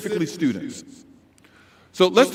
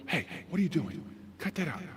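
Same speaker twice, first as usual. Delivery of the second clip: very soft, calm correction; brought right down; 14 dB quieter than usual. Shown as a demonstration. Adult to student once attention is got, quiet correction. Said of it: "then you bring it right down".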